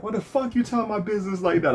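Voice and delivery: very high, mocking imitation of a soft voice getting angry; indignant, high-pitched mimicry; Yuki's soft-spoken outrage. Former friend accusing the speaker of gossip, high-voiced outrage. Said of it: "still had that soft spoken voice".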